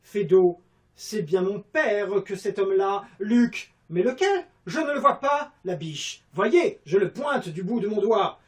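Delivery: loud, high, theatrical classical declamation; Molière theatre style. Pretend. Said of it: "à la Molière".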